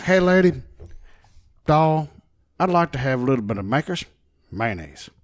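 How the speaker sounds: a put-on Texan ordering at the bar; mock Texas accent. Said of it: "with my Texas accent".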